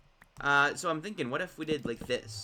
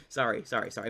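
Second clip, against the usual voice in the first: ordinary talk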